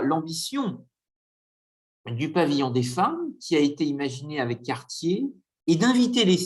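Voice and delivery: normal talking voice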